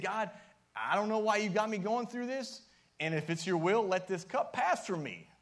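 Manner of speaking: high, loud pleading prayer to God; Jesus begging God in the garden, loud pleading cry; anguished cry. Acted.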